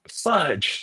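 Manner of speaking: mildly exasperated imitation; retold pissed-off curse. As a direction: imitate an annoyed reporter swearing. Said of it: "He was so pissed".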